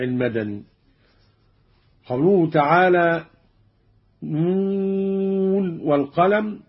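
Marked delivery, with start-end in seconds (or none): 4.2-6.6 long-held NUN in "نون والقلم"; slow tajwid recitation; measured Quran recitation. Shown as a demonstration. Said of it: "قرأ قالون بإظهار النون من الواو".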